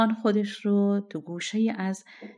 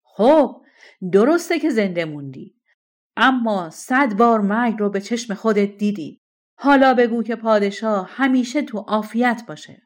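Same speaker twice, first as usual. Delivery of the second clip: angry king, loud and forceful; furious loud shout; 4 semitones higher, 9 dB louder than usual. A narrator's reading. King shouting at the defiant shepherd, furious. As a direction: bark it out in royal rage.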